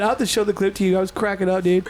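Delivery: ordinary talk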